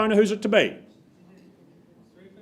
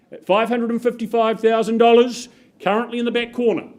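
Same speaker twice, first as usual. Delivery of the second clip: ordinary delivery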